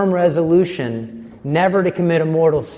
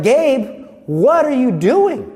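higher-pitched scolding sister; imitated stern reproach; confronting; 8 semitones higher, 4 dB louder, slower than usual. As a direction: demand answers like an exasperated nun. Sister confronts student in retold story.

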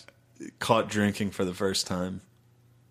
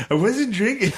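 high-pitched denial; 10 semitones higher, 4 dB louder than usual. Retold or imitated. Retold lie to dad.

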